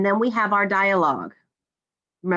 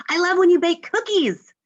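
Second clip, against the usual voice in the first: high, lively exclamation; excited exclamation; 10 semitones higher, 4 dB louder than usual. Acted. Teacher reading an example exclamation to students, excited. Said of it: "I exclaimed".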